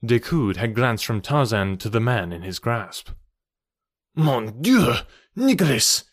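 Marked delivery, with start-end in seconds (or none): 4.1-6.0 high, astonished exclamation; shocked cry. A narrator's reading. Count recognizes the captive, shocked cry. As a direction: cry out in sudden recognition, pitch jumping up. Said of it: "he cried".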